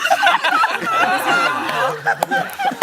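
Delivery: high squealing laugh. Real, not acted. Wrapping up the story for friends, high laugh.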